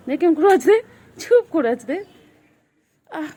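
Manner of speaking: high, loud delighted exclaim; excited. Real, not acted. Owner delighted at how her pet is sitting still, excited. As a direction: call out in delight. Amused at pet's pose.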